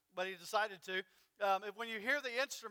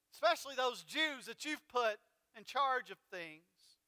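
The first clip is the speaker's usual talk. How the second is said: ordinary talk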